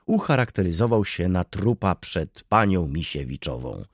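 ordinary delivery

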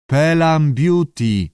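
high, loud creature slowly spelling out words; slow, squawky reading. A narrator's reading. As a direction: squawk it syllable by syllable. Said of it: "powoli, skrzekliwie sylabizował tekst". (powolne, skrzekliwe sylabizowanie)

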